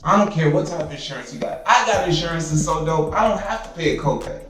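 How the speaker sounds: ordinary talk, nothing marked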